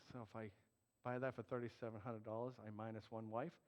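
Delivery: low, soft private musing; hushed inner monologue; thinking to himself. Retold or imitated. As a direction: voice a quiet calculation under your breath. Quietly calculating to himself in story.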